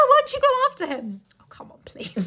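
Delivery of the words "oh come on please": soft unvoiced scoffing; whispered scoff. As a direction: breathe it dismissively.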